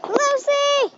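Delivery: high call across the yard. Real, not acted. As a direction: call the dog's name. Calling the dog in yard.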